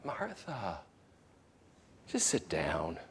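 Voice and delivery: soft, fading to a near whisper, tender and tearful; tender plea. Retold or imitated. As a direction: plead gently, almost whispering. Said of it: "a tear coming from his eyes".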